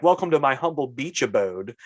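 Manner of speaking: ordinary talk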